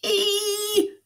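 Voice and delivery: very high, squealed mock howl; high-pitched "Ee!" squeal; pained howl. A narrator's reading. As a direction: squeal it out like a storybook yelp. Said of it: "howled Mister McGee".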